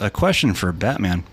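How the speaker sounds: normal talking voice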